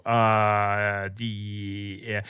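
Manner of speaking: low drawn-out hesitation, trailing away quietly; mimicked reluctant stammer, fading off; hesitant stalling. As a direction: stall uneasily, stretching the sounds out. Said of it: "And I go".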